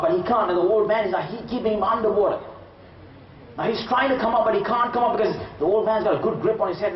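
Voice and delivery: dramatic build. A narrator's reading. Preacher telling the crowd about the drowning, high and urgent. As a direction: tell the drowning scene with rising tension.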